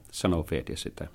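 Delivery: usual speaking voice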